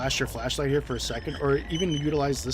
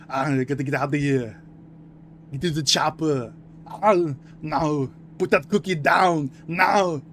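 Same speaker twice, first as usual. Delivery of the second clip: loud, slow Austrian action-hero voice, pitched up; Schwarzenegger impression; 3 semitones higher, 8 dB louder, slower than usual. Streamer showing off his Arnold impression, loud slow Austrian accent. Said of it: "a Schwarzenegger impression".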